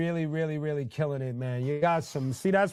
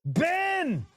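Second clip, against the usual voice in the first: excited call. Real, not acted. Greeting viewer by name.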